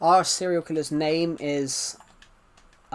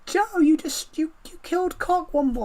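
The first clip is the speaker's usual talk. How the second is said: put-on girl in my class; 12 semitones higher than usual. Classmate accusing Joe of popping the balloon, high mock girl's voice. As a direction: squeak it out like an upset classmate. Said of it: "a girl in my class went".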